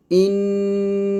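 ইন্না held long with a humming nasal ghunna on the doubled n. Shown as a demonstration. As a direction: stretch ইন্না slowly, humming the nn through the nose. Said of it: "নাকের ভিতর গুনগুন করে শব্দ উচ্চারণ করতে হয়".

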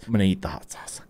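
whispered to himself, bracing; hushed resolve before the spoonful; softly whispered self-pep talk. Real, not acted. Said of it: "I keep talking because I don't wanna eat".

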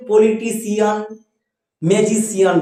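"পলিটিশিয়ান, ম্যাজিশিয়ান" with endings split into "সি-আন"; ভুল উচ্চারণ. Shown as a demonstration. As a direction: demonstrate the mistake, sounding "সিয়ান" in "পলিটিশিয়ান, ম্যাজিশিয়ান". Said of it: "আমরা এরকম ভুল উচ্চারণ করবো না". ("পলিটিশিয়ান, ম্যাজিশিয়ান"-এর শেষে "সি-আন")

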